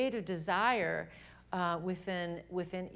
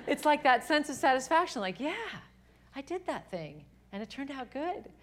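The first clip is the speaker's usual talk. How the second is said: excited; 7 semitones higher, 6 dB louder than usual. Real, not acted. Excited satisfaction, high and loud.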